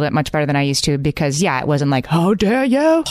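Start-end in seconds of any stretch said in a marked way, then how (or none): 2.1-3.0 mock outrage. Outraged parent quote.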